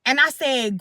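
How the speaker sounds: plain reading voice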